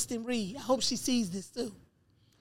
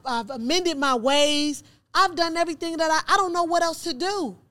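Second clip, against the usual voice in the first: higher, aggrieved protest, rising in force; retold anguished plea; 5 semitones higher, 5 dB louder than usual. Prisoner asking God why she was denied, retold hurt pleading. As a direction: plead your case, building to a loud high outburst.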